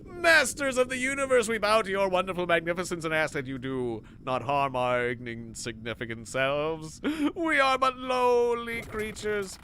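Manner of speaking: bow and scrape like a pleading creature; hammed-up servile alien pleading for mercy; grovelling alien. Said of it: "Oh, I'm begging".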